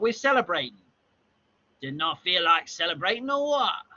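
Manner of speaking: hammed-up Cockney ribbing; Cockney accent. Cockney drinkers ribbing Bob about celebrating, broad accent. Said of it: "this Cockney stuff is really".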